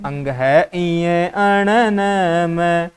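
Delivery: अंग है इये अण न म, each nasal letter slowly drawn out; correct pronunciation demo. Shown as a demonstration. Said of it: "ये जो पंचमा अक्षर हैं जिन्हें हम". (अंग है इये अण न म का धीमा, खींचा हुआ शुद्ध उच्चारण)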